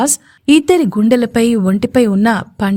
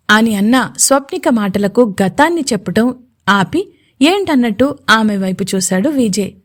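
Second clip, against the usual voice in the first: ordinary delivery, nothing marked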